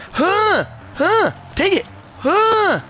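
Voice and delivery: high-pitched grunting yelps; short high bursts of effort; effort shout. Real, not acted. Racer forcing a risky inside line, high yelping.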